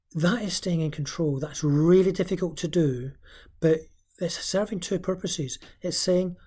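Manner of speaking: ordinary talk, nothing marked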